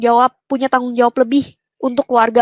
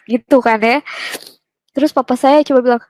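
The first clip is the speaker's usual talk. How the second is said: normal talking voice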